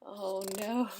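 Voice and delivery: plain reading voice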